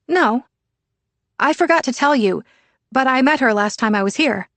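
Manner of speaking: put-on a teen filling mom in; chatty teen daughter. Sienna explains on the phone.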